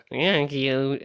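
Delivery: words through clenched teeth; jaw wired shut. Retold or imitated. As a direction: barely open the jaw. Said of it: "that voice".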